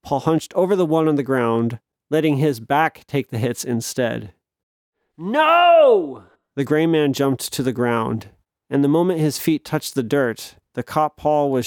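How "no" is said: sudden high shout. Acted. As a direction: yell it out in protest. Character cries out in protest.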